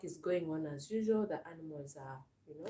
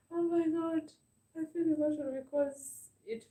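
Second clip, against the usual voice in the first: high, louder, tearful voice close to crying; fighting back tears, pitched way up; choking up; 10 semitones higher, 4 dB louder than usual. Real, not acted. Vlogger overwhelmed by kind messages, choking up. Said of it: "I don't want to cry".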